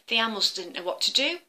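plain reading voice

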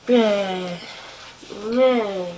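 normal talking voice

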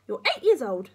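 loud, high granny snap; cross, forceful old-woman retort; snapping. Acted. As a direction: snap it at the boy. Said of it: "she snapped".

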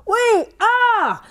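high, loud shout. Shown as a demonstration. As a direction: shout it out big. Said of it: "That was a loud one".

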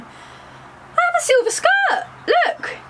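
very high, slow, drawn-out squeal; showy exclamation. Pretend. Vlogger unveiling her silver skirt, high showy exclamation. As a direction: draw it out high to show it off.

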